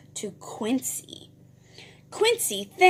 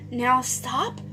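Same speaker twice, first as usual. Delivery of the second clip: usual speaking voice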